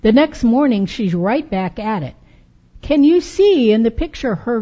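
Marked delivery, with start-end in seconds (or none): none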